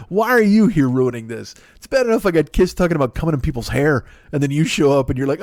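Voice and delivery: ordinary talk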